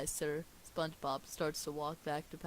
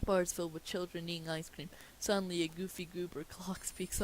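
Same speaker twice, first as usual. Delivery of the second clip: ordinary delivery, nothing marked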